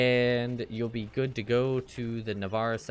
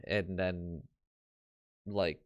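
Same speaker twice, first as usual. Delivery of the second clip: ordinary delivery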